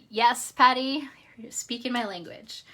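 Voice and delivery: loud, delighted call out; excited. Real, not acted. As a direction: cheer her name with delight. Excited reply to commenter.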